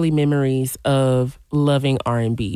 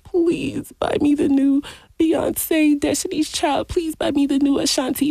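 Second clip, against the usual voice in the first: put-on begging child; 11 semitones higher than usual. DJ retells begging mom for new CDs, high mock pleading. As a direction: imitate your younger self pleading, pitched way up. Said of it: "like, begging her".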